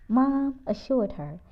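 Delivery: plain reading voice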